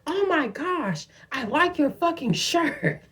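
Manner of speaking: high, drawn-out mock holler without volume; hollering sister. Sister complimenting a stranger's shirt, high mock holler. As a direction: imitate a high, slow gushing call. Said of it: "my sister hollered out some".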